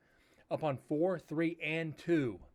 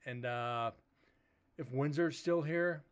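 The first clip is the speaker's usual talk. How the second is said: ordinary delivery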